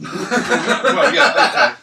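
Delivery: loud laugh through the words. Real, not acted.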